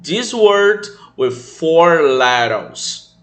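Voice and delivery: very slow, careful reading; slow English model. Shown as a demonstration. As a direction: spell it out slowly for the kids. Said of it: "essa palavra com quatro letras". (leitura lenta e cuidadosa)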